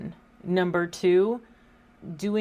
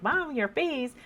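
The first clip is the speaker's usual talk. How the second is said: daughter's voice; 7 semitones higher than usual. Retold or imitated. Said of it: "sometimes she's like".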